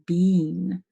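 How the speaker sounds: "bean" with a long ee; common mispronunciation. Shown as a demonstration. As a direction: say "bean" with the vowel of Jeep. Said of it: "lots of people wanna say".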